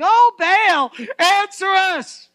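loud, high, stretched wail; desperate cry to Baal. Acted. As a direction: beg an idol at full voice. Prophets of Baal calling out.

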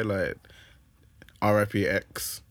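ordinary talk, nothing marked

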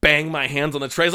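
normal talking voice

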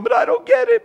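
high, loud whine; whining protest. Retold or imitated. Sinner whining at God, high and loud. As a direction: bleat like a child playing dumb. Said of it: "you stand there going".

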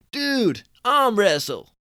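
high, loud Californian dude scoff. Acted. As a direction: squeak up the pitch like a cocky Californian. Billy voiced.

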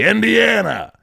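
loud high announcer call; Ed McMahon calling out. Retold or imitated. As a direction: belt the nickname like a TV sidekick. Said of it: "He'd be".

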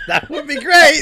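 high, loud, sugary put-on voice; sugary fake-polite voice; mock sweet request.

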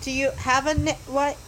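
ordinary talk, nothing marked

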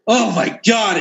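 ordinary delivery

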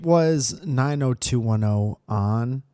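ordinary talk

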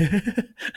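high-pitched helpless giggle; mimicked tittering laugh; imitated giggling. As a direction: giggle like a friend enjoying someone's misfortune. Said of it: "he just started giggling behind him".

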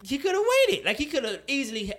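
high, loud burst of insistence; emphatic exclamation. Real, not acted. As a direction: throw it out with force.

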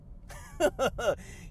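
a voiceless, breathy chuckle; breathless giggle. Real, not acted. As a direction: laugh on breath alone. Signature laugh between points.

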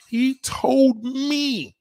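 very slow, high emphasis; slow drawn emphasis. Real, not acted. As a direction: stretch it out slowly.

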